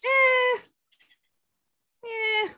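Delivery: high-pitched joking "eh"; sarcastic. Pretend. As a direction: squeak out a mock-reluctant little noise. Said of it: "I was being sarcastic".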